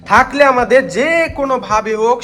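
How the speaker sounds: loud, slow, high call into the dark room; calling out to spirits. Real, not acted. Ghost hunter loudly calls any spirit in the abandoned washroom.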